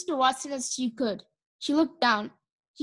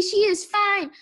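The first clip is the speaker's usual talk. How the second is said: high, excited announcement; joyful cheer; 7 semitones higher, 4 dB louder, slower than usual. A narrator's reading. Baby cheering that Mercy is fine, joyful. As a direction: announce it with delighted relief.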